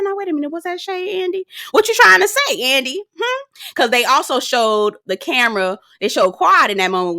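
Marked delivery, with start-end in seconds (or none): 0.0-3.4 high, sassy mock challenge to Andy; sassy callout. Vlogger calling out Andy's shade to viewers, sassy and high. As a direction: call Andy out with playful suspicion.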